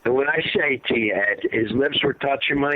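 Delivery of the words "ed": ordinary talk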